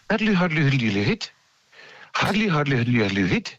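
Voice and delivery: lilting, liquid warbler phrase falling, then flicking up; softly rippled bird warble; soft bird song. Retold or imitated. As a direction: imitate a relaxed, gentle songbird. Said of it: "So ganz weich".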